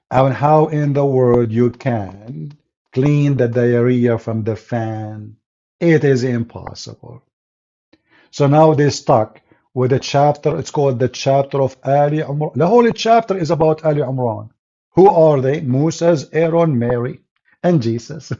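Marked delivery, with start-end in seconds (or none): none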